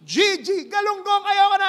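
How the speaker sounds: very high, loud cry; despairing scream. Acted. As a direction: scream and jump. Third worker despairs.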